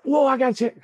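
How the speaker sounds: high, amazed exclamation. Retold or imitated. Surprised at his paycheck.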